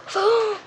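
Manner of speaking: high, quick gasp; sharp gasp. Real, not acted. Unboxer struggling with the packaging, sharp gasp. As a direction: suck in a startled breath.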